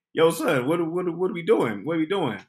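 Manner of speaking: jittery, asking again and again; nervous repeat. Retold or imitated. Panicked questions to DL.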